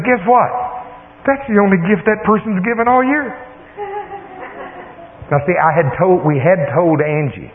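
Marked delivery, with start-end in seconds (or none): 0.1-3.3 high-pitched, eager reveal; excited daughter. Retold or imitated. Daughter tells dad more about the donor, excited. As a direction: imitate a daughter eager to share news. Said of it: "She's all excited".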